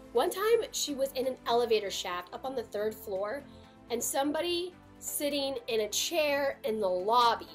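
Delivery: normal talking voice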